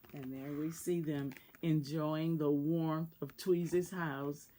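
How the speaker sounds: low, hushed picture aside; soft murmured aside to the listeners; quiet aside. Real, not acted. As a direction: drop the voice to point at the page.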